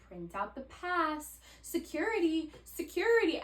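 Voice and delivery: high, sing-out pointing to signs; mock step-by-step directions. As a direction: spell it out as if for a toddler. Mimics idiot-proof airport signs pointing.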